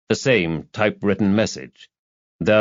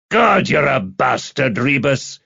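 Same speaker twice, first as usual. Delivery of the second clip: slow, higher, louder-edged curse; gritted-teeth exasperation; 5 semitones higher, 3 dB louder than usual. Acted. Campbell to Rebus after hearing the fight result, exasperated. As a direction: curse a teasing friend through gritted teeth. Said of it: "Gritting his teeth".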